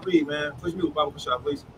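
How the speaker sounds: usual speaking voice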